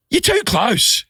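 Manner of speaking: high, exasperated outburst; losing his cool. Retold or imitated. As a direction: snap it at someone crowding you. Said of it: "I've lost my, my, my cool".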